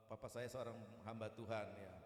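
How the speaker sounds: ordinary delivery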